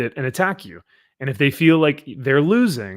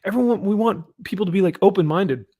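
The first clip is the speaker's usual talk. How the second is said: high-pitched mock sincerity; mocking imitation; 6 semitones higher than usual. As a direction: imitate them in a raised, mocking voice. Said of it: "they're like".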